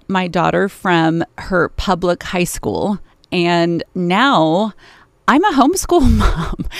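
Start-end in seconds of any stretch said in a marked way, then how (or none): 5.3-6.7 laughing through homeschool mom, pitched up; can't keep straight face. Real, not acted. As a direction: break into a real laugh mid-phrase. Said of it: "can't even say that with a straight face".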